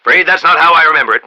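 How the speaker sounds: ordinary talk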